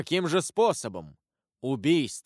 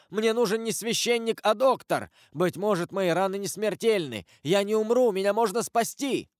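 desperate plea; 7 semitones higher than usual. A narrator's reading. Dying man begs for doctor.

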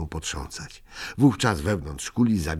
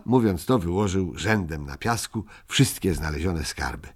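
ordinary delivery, nothing marked

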